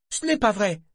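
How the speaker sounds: put-on high, whiny girl's protest. A narrator's reading. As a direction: protest like a sulky teen. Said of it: "protesta Malta".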